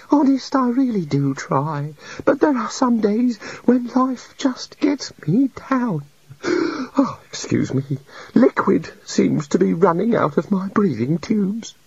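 high, slow and whispery, close to crying; tearful little god. A narrator's reading. The god pours out his woes, tearful. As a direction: fight back the sniffles.

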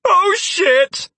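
high, loud panicked cry; sudden panic at the attack. A narrator's reading. Snakes target the hero.

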